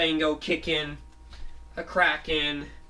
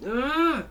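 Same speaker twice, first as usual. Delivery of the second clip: disgusted groan; 11 semitones higher, 4 dB louder than usual. Retold or imitated. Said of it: "He says".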